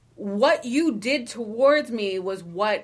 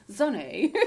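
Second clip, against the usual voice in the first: put-on English accent, name lilting up. Teasing one of the kids in a store, English accent. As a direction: call out in a playful English accent. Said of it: "gonna speak in an English accent".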